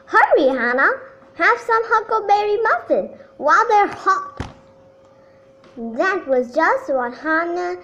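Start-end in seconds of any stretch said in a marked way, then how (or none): 0.0-4.4 loud eager call; hollering back. A narrator's reading. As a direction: holler back to hurry her. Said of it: "Homer hollered back".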